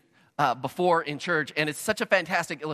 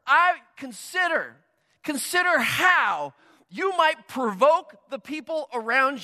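preaching shout; 7 semitones higher, slower than usual. Real, not acted. Pastor urging the congregation to provoke love, slow forceful shout. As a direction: drive it home to the whole room. Preacher driving home the point.

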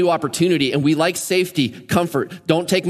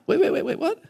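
mock surprise; 11 semitones higher than usual. Reacts to Jesus' prediction.

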